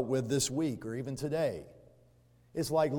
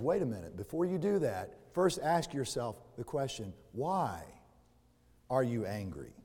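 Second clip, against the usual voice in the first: soft, lower, calm voice of God; God quietly stopping an angry man; gentle caution; 5 semitones lower, 6 dB quieter than usual. Acted.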